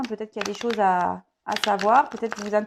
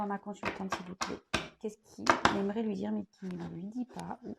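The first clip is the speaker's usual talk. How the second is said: softly murmured prayer to her guides, much softer; quiet invocation; 5 dB quieter than usual. Acted. Tarot reader asking her guides for a message, hushed invocation. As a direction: hush your voice and ask the guides.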